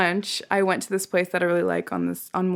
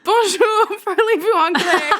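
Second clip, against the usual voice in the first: high, loud, laughing mimicry; mimicked eager tourists; 11 semitones higher, 5 dB louder than usual. New Zealand couple copying the server's tip, gleefully high. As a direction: parrot the French greeting high and gleeful. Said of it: "And they were like".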